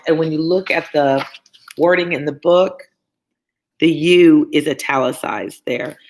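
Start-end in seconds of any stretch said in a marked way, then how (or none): 3.9-4.5 "you" hit hard and loud; stress on you. Shown as a demonstration. Reader stressing the father's "you". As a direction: lean on "you" like it's italicized. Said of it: "So he's emphasizing it".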